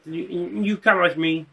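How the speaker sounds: lightly voiced froggy counselor; hesitant puppet-frog impression, understated; Kermit the Frog. Counselor summoning a student, lightly froggy voice. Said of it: "This guy sounded like Kermit the Frog".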